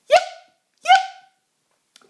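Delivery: very high, loud yips; sharp squeaky yips; fox yips. A narrator's reading. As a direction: yap like a fox. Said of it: "barks the fox".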